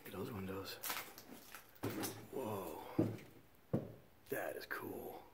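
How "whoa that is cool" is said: ordinary talk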